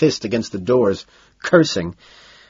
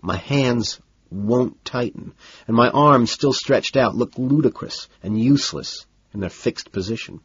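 usual speaking voice

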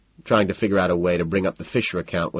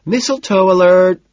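high, loud, drawn-out announcement; ringing high holler; shout; 12 semitones higher, 9 dB louder, slower than usual. A narrator's reading. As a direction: shout it over a crowded party. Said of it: "I shout".

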